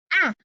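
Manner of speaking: "ah" as the vowel starting ask; short A sound. Shown as a demonstration. Teacher naming the shared first sound, short "ah". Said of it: "the short A sound that says ah".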